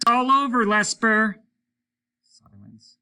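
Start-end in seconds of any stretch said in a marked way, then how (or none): 0.1-1.4 much higher, loud shout down the line. A narrator's reading. Hollis shouts into the telephone at Lesper, loud yell. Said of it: "shouted into the telephone".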